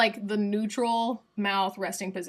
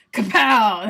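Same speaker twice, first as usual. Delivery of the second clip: much louder, bursting kapow; 12 dB louder than usual. Real, not acted. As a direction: blast it out. Trying the voiced epiglottal.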